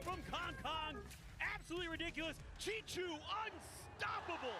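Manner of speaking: high, loud amazed call; excited caster. Real, not acted. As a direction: marvel at the play. Co-caster hype.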